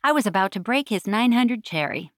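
usual speaking voice

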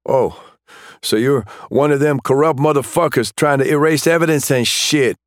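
high, sneering accusation; young thug accusing. Acted. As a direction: sneer at the crooked cop. Suspect accusing cop of corruption.

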